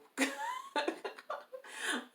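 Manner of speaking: very high burst of laughter; real high laughter. Real, not acted. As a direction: crack up high. Laughs at herself.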